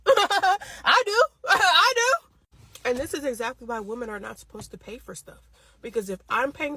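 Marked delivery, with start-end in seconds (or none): 0.1-2.9 high laughing confession. Real, not acted. Laughing admission.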